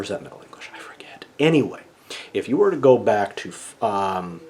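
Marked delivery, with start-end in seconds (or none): 0.0-1.3 muttered whisper of self-doubt; whispered aside. Real, not acted. Teacher doubts his own date to the class, whispering. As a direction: drop to a whisper, checking himself. Unsure aside mid-lecture.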